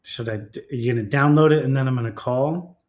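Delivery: ordinary delivery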